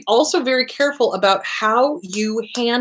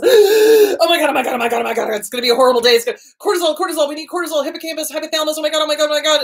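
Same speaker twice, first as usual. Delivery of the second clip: panicking amygdala; 6 semitones higher, much faster than usual. Retold or imitated. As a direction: rattle it off like a panicking brain. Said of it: "And then the amygdala goes".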